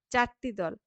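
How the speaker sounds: normal talking voice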